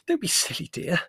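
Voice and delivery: high, gasping Mother; breathless. A narrator's reading. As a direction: gasp first, then scold out of breath. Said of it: "said Mother breathlessly".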